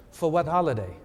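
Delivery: lightly put-on French accent. Seatmate questions the card writer, light mock French accent. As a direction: question him with a hint of French. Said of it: "in kind of this French accent".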